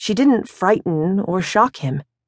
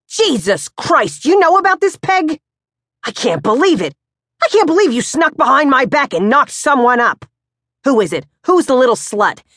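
furious father; 8 semitones higher, 3 dB louder than usual. Acted. Pops accusing his son of knocking someone up, furious. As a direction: explode in disbelief at your son.

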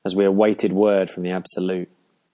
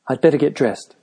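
hasty; 6 semitones higher than usual. A narrator's reading. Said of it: "she said hastily".

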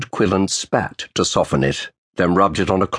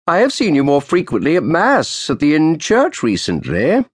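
put-on old official's sharp greeting; 8 semitones higher than usual. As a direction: snap out a cold greeting to the lawyer. Roland's voice.

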